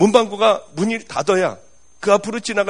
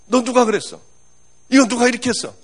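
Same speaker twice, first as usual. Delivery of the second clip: higher, louder demanding who did it; alarmed questioning; 6 semitones higher, 5 dB louder than usual. Retold or imitated. School nurse confronting the girl, alarmed and demanding. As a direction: press the girl hard for an answer.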